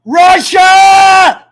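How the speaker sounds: high, loud holler; big drawn-out yell; shouting out. Pretend. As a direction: bellow the name, drawing it out. Calling out after freeze-up.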